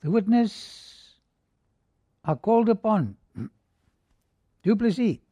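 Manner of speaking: a hint of a court official calling out; stern judge. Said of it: "And looking at the judge".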